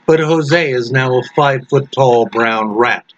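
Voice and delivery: plain reading voice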